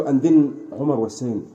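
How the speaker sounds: usual speaking voice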